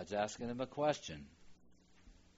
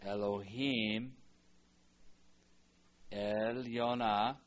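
slow model reading; much slower than usual. Shown as a demonstration. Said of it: "slow Hebrew model reading".

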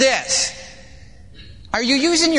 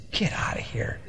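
whispered brush-off, very soft; acted-out whispered contempt; hushed dismissal; 5 semitones lower, 11 dB quieter than usual. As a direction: send them away under your breath. Krishna dismisses him, voice dropped.

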